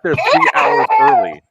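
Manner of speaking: high, slow, stretched-out complaint; exasperated whine. Real, not acted. Complaining to friends about the delay, in an exasperated high whine. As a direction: whine it in disbelief.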